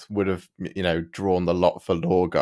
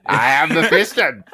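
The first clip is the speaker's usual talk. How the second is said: high, slow sneering villain voice, louder; sneering Mephiston; 8 semitones higher, 4 dB louder, slower than usual. Retold or imitated. Imitating the audiobook Mephiston with a weird sneer. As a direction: sneer out the title grandly. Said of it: "this weird sneer all the time".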